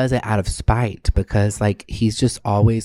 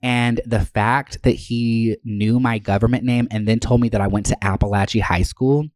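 ordinary delivery

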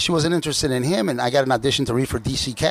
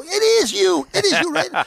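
much higher, louder insistence; imitating fans who refuse to believe him; insistent fans; 4 dB louder than usual. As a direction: squeal it like you won't take no. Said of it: "they'd go".

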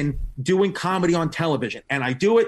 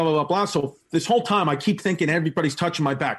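ordinary talk

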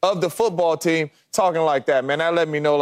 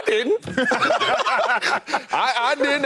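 laughing through the words; high, laughing denial; 4 semitones higher, much slower than usual. Real, not acted. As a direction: giggle it out. Laughing at his own point.

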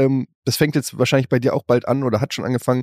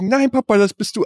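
put-on higher child's voice; imitating little son; 7 semitones higher than usual. Small son correcting his dad about the drawing, high child voice. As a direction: pipe up like a small boy.

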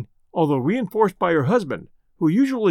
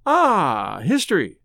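sagely; slower than usual. Acted. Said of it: "he observed sagely".

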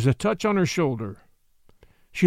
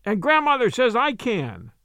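put-on high child's answering call; calling back; 6 semitones higher than usual. A narrator's reading. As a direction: call back to your friend, pitched up. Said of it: "called back Submit".

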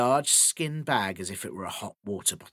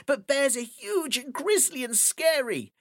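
put-on young boy, pitched high; small boy worrying aloud; 12 semitones higher than usual. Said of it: "said the boy".

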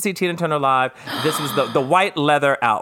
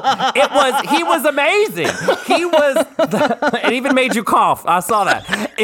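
laughing; 10 semitones higher, 3 dB louder, slower than usual. Real, not acted. Laughing about Cocker.

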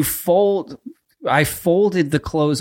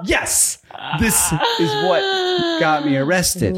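high, cracking laugh-cry; laughing confession; 8 semitones higher than usual. Real, not acted. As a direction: squeal it out through laughter. Confessing embarrassing detail.

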